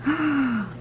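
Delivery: high, soft gasp. A narrator's reading. Red comes into Grandma's bedroom, startled gasp. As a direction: draw in a startled breath.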